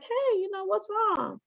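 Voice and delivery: put-on high, soft and tender question to a crying child; teacher gently asking a crying kindergartner what's wrong; gentle coaxing. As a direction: coax her softly like a kind teacher. Teacher comforting crying girl.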